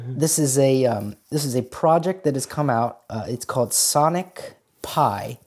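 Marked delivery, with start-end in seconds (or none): none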